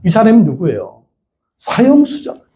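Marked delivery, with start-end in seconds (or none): none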